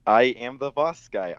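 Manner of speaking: usual speaking voice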